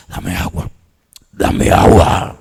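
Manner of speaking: hushed, voiceless begging; tormented whisper. Acted. Acts tormented possessed person begging water. (susurro atormentado)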